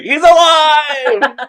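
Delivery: high, loud, slow joyful cry; hammed-up Frankenstein cheer; excited cry. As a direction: shout it out like a miracle happened. Lizard revives, family cheers.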